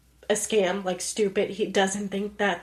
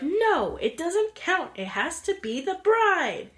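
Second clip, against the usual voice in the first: mock aunt voice, much higher; high-pitched aunt; 9 semitones higher than usual. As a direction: squeak it out like the bossy aunt. Aunt voiced in movie recap.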